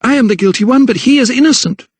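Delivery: high, desperate confession; pleading. A narrator's reading. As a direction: plead for another's life. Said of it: "she pleaded".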